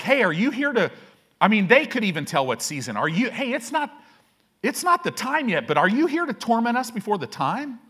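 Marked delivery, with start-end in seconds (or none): 0.0-0.9 higher, startled demon voice; panicked demons. Retold or imitated. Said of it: "They're like". 3.0-7.8 higher, startled demon voice; panicked demons. Retold or imitated. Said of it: "They're like".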